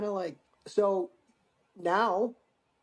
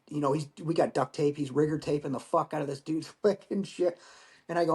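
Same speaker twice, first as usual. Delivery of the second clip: normal talking voice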